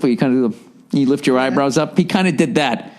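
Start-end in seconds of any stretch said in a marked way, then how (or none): none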